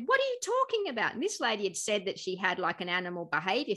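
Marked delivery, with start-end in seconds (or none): 0.1-1.1 high, disbelieving challenge; incredulous woman. Retold or imitated. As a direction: shoot it up in outraged disbelief. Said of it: "And she went".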